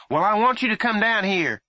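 loud high firm call; mother putting her foot down; insistent mother. A narrator's reading. Mother insisting her son come downstairs, loud.

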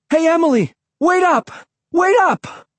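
high, urgent calling. A narrator's reading. Kid running after his sister, urgent calling out. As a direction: call after someone running off ahead.

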